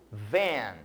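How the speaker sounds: van said the English way, for students to repeat. Shown as a demonstration. Teacher models van for students to repeat, in English pronunciation. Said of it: "repitam comigo".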